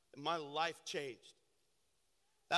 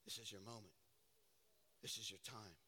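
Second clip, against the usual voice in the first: much softer, lower, tender call; God's gentle call; 11 semitones lower, 20 dB quieter than usual. Retold or imitated. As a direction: gently invite him forward. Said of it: "And God's saying".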